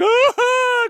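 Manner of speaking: high, loud wailing cry; mock crying wail. Imitating the sobbing driver after the crash, loud high wail. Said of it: "crying her eyes out".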